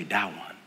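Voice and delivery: soft, low "That one" said as "dah-wun"; toddler pronunciation. Retold or imitated. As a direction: quietly imitate a toddler's "That one" with d and no t. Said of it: "she'll say".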